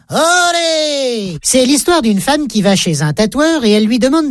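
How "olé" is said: long, high bullfight cry; exclaimed olé. Acted. Housewife pivoting away from falling child, exclaiming. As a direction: draw it out high like a bullfight crowd. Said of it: "et s'exclame".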